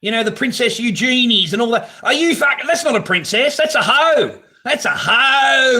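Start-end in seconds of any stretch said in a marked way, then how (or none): 4.7-5.8 loud, slow, drawn-out yell; furious shouted insult; slow angry shout. Real, not acted. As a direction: drag it out and bellow it. Ranting about the royal family.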